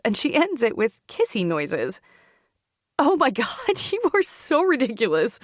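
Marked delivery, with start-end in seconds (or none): 3.0-5.4 higher-pitched, amused protest through laughter; around a laugh. A narrator's reading. Teased by her sister on the phone, laughing. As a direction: let a laugh break into the words. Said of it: "I say around a laugh".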